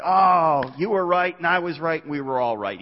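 loud, bright, pleased with everyone; hammed-up happy reunion; mock cheerful agreement. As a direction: gush like everyone won. Mocking everyone agreeing in heaven.

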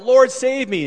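high, loud panicked plea; crying out. Acted. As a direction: cry out for rescue. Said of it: "he cries out".

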